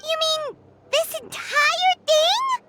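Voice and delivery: high, slow, incredulous question; amazed disbelief. Acted. Companion sees the entire course, amazed disbelief. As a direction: draw it out in astonishment, climbing high at the end.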